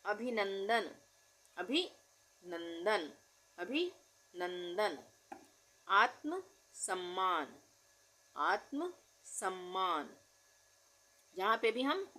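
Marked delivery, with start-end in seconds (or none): 1.6-5.0 अभिनंदन drawn out, every syllable clear; slow dictation. Shown as a demonstration. Teacher repeating a word slowly for students. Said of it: "dictation repeat". 5.9-10.2 आत्म सम्मान slow, half त of आत्म stressed; slow dictation. Shown as a demonstration. Teacher showing the half letter in आत्म. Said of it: "आधे अक्षर का उच्चारण हो रहा है".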